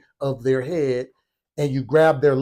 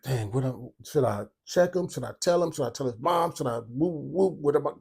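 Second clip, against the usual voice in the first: softer, hushed inner monologue; muttered racing thoughts to himself; 8 dB quieter than usual. Retold or imitated. Deciding how to react after the slap, hushed inner voice. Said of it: "in my mind real fast".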